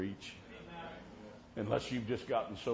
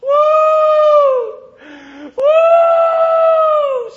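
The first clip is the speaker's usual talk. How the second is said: very high, loud shouting whoop; a wife whooping for joy in church; joyful whoop; 22 dB louder than usual. Retold or imitated. Wife rejoicing at the altar, whooping loudly. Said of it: "She started going".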